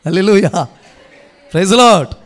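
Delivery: loud, punched praise shout; congregation call. Real, not acted. Calling congregation to respond. (సంఘాన్ని ఉద్దేశించి గట్టిగా స్తుతి నినాదం)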